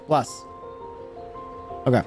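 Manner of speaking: normal talking voice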